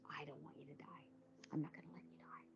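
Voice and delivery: hushed, whispered comfort; whispered reassurance. Retold or imitated. Surgeon reassuring a frightened patient in a whisper.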